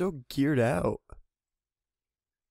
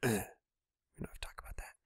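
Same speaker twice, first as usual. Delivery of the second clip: whispered aside; 10 dB quieter than usual. Real, not acted.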